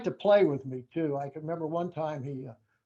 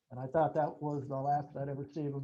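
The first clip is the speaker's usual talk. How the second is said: ordinary talk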